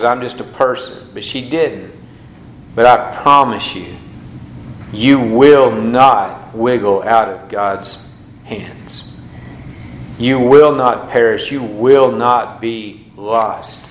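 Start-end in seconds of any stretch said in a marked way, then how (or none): 2.7-13.8 slow, low, emphatic promise to the congregation; preaching assurance. Acted. Pastor assuring his congregation, slow and emphatic. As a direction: press each word home slowly.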